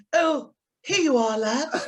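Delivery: "Oh, here you are, lads" in mock plummy RP with a laugh at the end; posh RP. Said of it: "he made us do it in R P".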